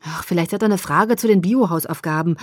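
ordinary delivery, nothing marked

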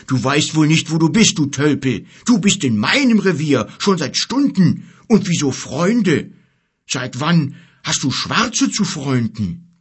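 loud, high, outraged bear voice; indignant bear. Acted. Bear telling the giant off in his territory, indignant. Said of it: "empörte sich der Bär".

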